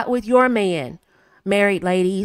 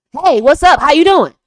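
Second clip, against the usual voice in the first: loud, higher, brash hello; too loud greeting; 6 semitones higher, 9 dB louder than usual. Shown as a demonstration. Wife greeting tired husband too loudly. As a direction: blast the greeting without care. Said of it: "Not appropriate".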